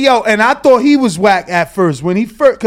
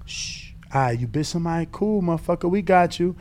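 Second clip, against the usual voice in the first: hushed; 3 semitones lower, 10 dB quieter than usual. Retold or imitated.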